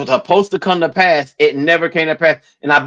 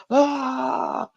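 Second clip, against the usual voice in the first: high, soft mock gasp; mock awe; 11 semitones higher, 8 dB quieter than usual. Storyteller playing the congregation hearing a prophecy, mock-amazed. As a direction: imitate an awestruck crowd.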